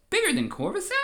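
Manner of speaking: high squeaky Gungan pipe; Jar Jar. Acted. Jar Jar asking Palpatine, high goofy Gungan voice. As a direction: go way up, goofy and puzzled. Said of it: "And Jar Jar says".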